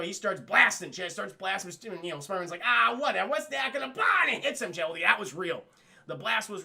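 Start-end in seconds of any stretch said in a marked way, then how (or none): 2.6-4.2 loud, high dismissive shout; mock battle shout. Spider-Man shrugging off the blast, loud shout. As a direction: yell it mid-fight.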